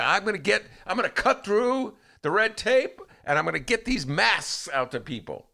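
imitating Willie Wilson. Host mimicking politician's promise to cohost, high mock boast. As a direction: puff it up like a campaign promise. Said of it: "Willie Wilson goes around and says".

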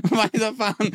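Weiterfahren verschlafen und höher, noch lachend nachgemacht; halb schlafend. Retold or imitated. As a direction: den dösenden Polizisten andeuten. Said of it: "der ist so halb am Schlafen". (angedeuteter verschlafener Polizist, Hand winkend)

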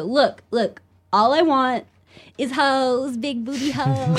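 imitating Colin. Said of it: "he'd go".

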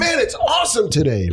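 very high, put-on excitement; overly enthusiastic. Shown as a demonstration. Host showing off his acting trick, overly enthusiastic fakery. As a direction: fake being thrilled, voice shooting up. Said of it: "how to be overly enthusiastic even when I'm not".

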